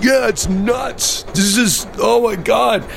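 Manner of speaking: high, hammed-up pretend awe; mock amazement. To his friend on the blind ride, fake wonder. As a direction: fake excitement to fool him.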